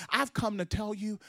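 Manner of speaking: ordinary delivery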